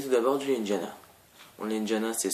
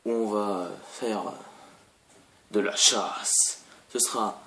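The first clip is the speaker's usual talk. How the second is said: slow announcer; slower than usual. Pretend. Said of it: "j'ai un peu trop bu".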